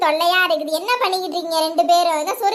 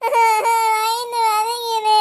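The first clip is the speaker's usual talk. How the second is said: high, long sobbing wail; crying in pain; 4 semitones higher, much slower than usual. Acted. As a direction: sob the words out, very slow and high. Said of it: "வந்ததுல இருந்து அழுதுகிட்டே இருக்கியாம்ப்பா". (கதறி இழுத்து அழுதல்)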